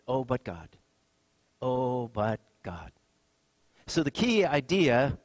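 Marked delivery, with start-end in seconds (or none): 1.6-2.9 drawn-out, hushed OH, BUT GOD; slow and low, with a long "Oh"; slow, weighty repeat. Real, not acted. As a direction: stretch each word and let it sink in softly. Repeats phrase slowly for weight.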